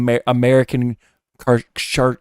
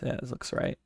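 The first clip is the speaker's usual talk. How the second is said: ordinary talk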